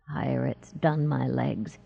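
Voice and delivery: slow, low, drugged slur; lazy slur. A narrator's reading. Drugged boy answering about his legs, lazy slur. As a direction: drawl it softly, lazily, as if drugged. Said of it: "his voice slurred with dragon salt".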